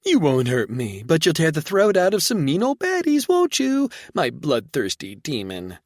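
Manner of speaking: woman cooing to her pup, very high sing-song. Acted. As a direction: coo sweetly about something savage. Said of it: "Reba spoke in a sing-song voice".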